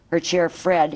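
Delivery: ordinary delivery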